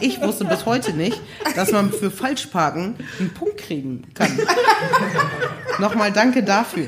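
real laughter breaking through the words; talking through giggles; laughing. Real, not acted. Laughing through parking-ticket story.

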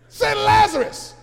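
loud, high preaching shout; preaching at full force. Acted. Preacher to church, loud high preaching shout. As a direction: drive it home to the congregation.

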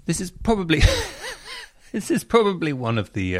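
high-pitched, helpless giggle through the words; laughing. Real, not acted. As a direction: try to introduce it while laughing. Host breaks up introducing story.

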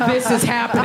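acted bystander remark, fairly contained; passengers' disbelief, first take. Passengers watch the fight on the night bus, contained disbelief. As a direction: say it as an onlooker taking it in. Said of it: "everyone was sitting there like".

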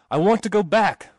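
high, loud panicked demand; screamed. A narrator's reading. Terrified passenger demanding to turn back, screamed. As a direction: cry it out in alarm, pitch well up. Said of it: "Mister Bond screamed".

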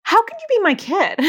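high, exasperated mock disbelief at her daughter. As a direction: play up the shock that your own kid doesn't know. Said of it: "I'm like".

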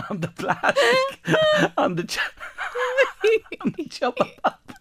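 high, squeaky words through real laughter; helpless laughter. Real, not acted. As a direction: struggle to finish the sentence while corpsing. Host corpsing during commentary.

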